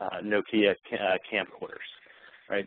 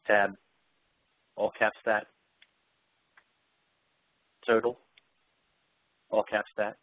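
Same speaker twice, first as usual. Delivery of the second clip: deliberate command-by-command dictation with pauses; showing Outlook dictation to the audience; live dictation. Shown as a demonstration. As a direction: dictate each phrase cleanly to the computer.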